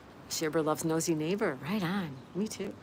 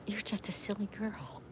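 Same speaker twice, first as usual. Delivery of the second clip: soft, whispered fondness; affectionate pet whisper; 3 semitones higher, 7 dB quieter than usual. Real, not acted. Streamer to her silly pet girl, affectionate whisper.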